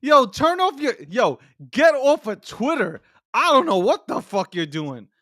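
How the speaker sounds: high-pitched, exasperated telling-off; worked-up scolding of an absent friend; exasperated appeal. Acted. Mock plea to absent Charles.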